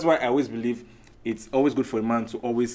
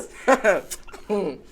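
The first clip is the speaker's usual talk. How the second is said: high, loud burst of laughter; 9 semitones higher, 5 dB louder than usual. Real, not acted. Man teased by the panel, loud laugh.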